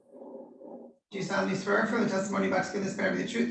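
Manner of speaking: normal talking voice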